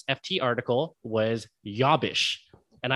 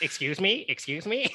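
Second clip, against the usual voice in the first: higher, mock-baffled American; imitated American accent; 5 semitones higher, 4 dB quieter than usual. American mimicking an Australian's American, baffled mock accent. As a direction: query it in an exaggerated American accent. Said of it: "doing an American accent".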